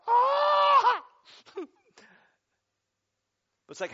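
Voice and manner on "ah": huge high yelp, much louder; a startled scream of alarm; panicked shout. Retold or imitated. Pastor dreading two guests at once: loud panicked yelp. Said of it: "And I'm like".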